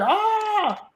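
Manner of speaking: high imitated charging yell; mock battle cry. Tim Toole charging the armed men, mock battle cry. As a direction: shriek like a man charging.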